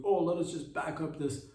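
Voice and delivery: sarcastic mimic of a staged scene; mock dumb narrator. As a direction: play it like a clueless bystander. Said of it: "So realistic, too".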